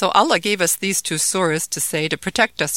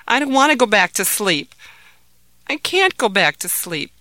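scared refusal; 6 semitones higher, 7 dB louder than usual. Acted. Scared boy refuses sleep.

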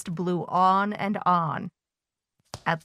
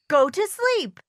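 shouted; 12 semitones higher than usual. Acted. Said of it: "shouted Toad".

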